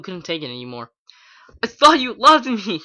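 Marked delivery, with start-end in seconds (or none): 1.6-2.8 high, loud heartbroken cry; anguished wail. A narrator's reading. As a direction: wail it out at her betrayer. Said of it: "she waited".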